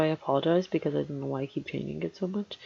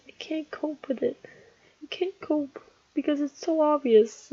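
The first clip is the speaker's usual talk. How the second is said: high, whining frustration; whiny complaint; 8 semitones higher than usual. Real, not acted. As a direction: moan it like you just can't take it. Frustrated over uneven drawing.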